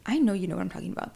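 usual speaking voice

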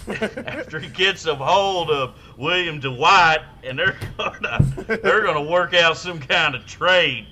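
loud, slow, high mock announcer drawl; hammed-up hype man. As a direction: ham it up like a radio sports blowhard. Hammed-up baseball trade rant.

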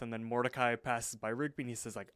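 normal talking voice

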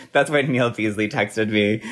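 ordinary delivery

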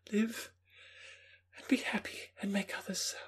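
put-on high young Justine, holding back tears; half-suppressed emotion. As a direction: say farewell to Elizabeth, fighting tears. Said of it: "in a voice of half-suppressed emotion".

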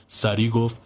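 usual speaking voice

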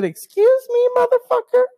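very high, loud indignant squeal; loud, piercing-high retort; indignant outburst. Retold or imitated. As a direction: shoot way up high in outraged disbelief. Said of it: "I did say it like that".

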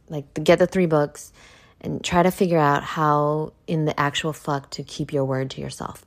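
normal talking voice

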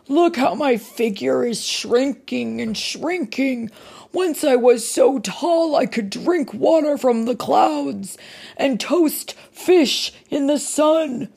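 a big giant pleading his case; whining giant. Acted. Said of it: "whined the giant".

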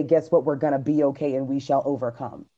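usual speaking voice

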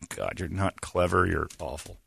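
ordinary talk

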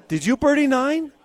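high, surprised question; eager Lee. Retold or imitated. Lee asking his partner, excited. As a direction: ask it wide-eyed and keen. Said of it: "He goes".

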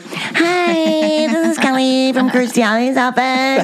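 very high mock phone greeting; imitation de Kelly au téléphone. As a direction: exaggerate a squeaky receptionist's sing-song. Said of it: "but you do sound like, you go like".

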